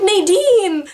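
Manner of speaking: high, loud cry of the name; calling out. Retold or imitated. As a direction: yell her name in the nightmare. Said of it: "And I was like".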